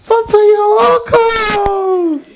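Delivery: high, slow, drawn-out greeting; mock fawning welcome. Preacher mocking a churchgoer greeting the pastor, high and drawn out. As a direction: overdo the welcome like a flatterer. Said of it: "dress nice and say".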